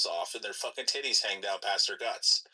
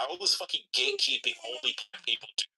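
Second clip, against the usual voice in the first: normal talking voice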